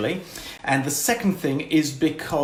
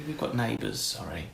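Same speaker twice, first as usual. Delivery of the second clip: dropped to a hush; quiet, apologetic, keep it down; 5 semitones lower, 9 dB quieter than usual. Real, not acted. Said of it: "Shh, our neighbours can hear".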